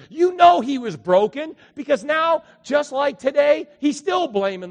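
loud, high, fervent preaching; preaching at full force. Acted. Pastor to the congregation on Sunday, loud fervent preaching. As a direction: build the praise to the rafters.

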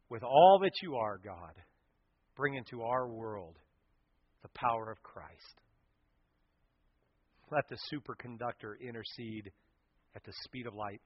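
fervent pastoral prayer over music; closing prayer. Acted. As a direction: pray it reverently, swelling in places.

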